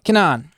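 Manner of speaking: "Kenan" with a firm, long second syllable; stress on last syllable. Shown as a demonstration. Preacher confirming the Hebrew rule, "Kenan" stressed on the last syllable. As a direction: say "Kenan" as keh-NAHN. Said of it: "accent is always on the last syllable".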